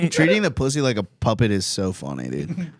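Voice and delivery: usual speaking voice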